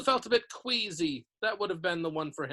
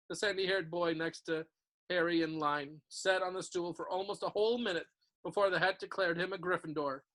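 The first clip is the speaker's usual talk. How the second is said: ordinary delivery, nothing marked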